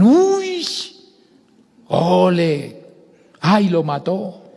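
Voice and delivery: high, loud drawn-out exclamations; mock spectator shouts. Preacher imitates fans enjoying the spectacle, shouting. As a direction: cry out like an excited crowd. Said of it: "o gritan".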